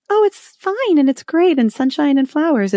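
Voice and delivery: mock forced cheer. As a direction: parody a denial that everything is perfect. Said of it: "it's not like I'm just".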